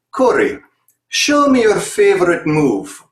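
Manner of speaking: imitating a Russian circus coach in broken English; terrible Russian accent. Coach calls out to Corey in a mock Russian accent. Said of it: "please forgive the terrible Russian accent".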